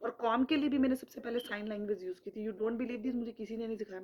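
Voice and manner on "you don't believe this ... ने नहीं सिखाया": usual speaking voice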